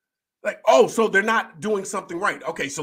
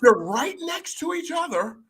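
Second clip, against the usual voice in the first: high-pitched, scandalized imitation; mock Karen; 12 semitones higher than usual. Coach mimics host judging young churchgoers, mock-scandalized.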